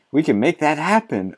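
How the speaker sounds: hammed-up zeal, like a cheerleader for the plan; mock excited author. Reading the author's line as an eager, hammed-up author. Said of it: "he's getting excited now".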